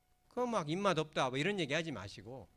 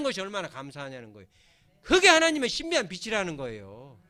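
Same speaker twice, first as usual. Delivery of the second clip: louder and louder, building to a loud preaching climax; preaching at full force; 5 semitones higher, 8 dB louder than usual. Acted. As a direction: preach it, building up to a ringing peak. Sermon rising to full force.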